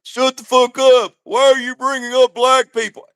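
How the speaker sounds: usual speaking voice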